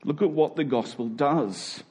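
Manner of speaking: ordinary talk, nothing marked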